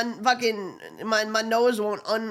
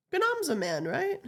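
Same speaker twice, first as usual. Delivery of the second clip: ordinary delivery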